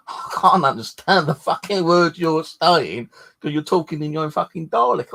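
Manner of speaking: mock-exasperated teasing, pitched high; exasperated jibe. Retold complaint to YouTuber.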